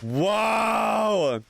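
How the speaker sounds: high, loud drawn-out whoa; amazed whoa. Real, not acted. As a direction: let the wonder burst out. First sight of the game world.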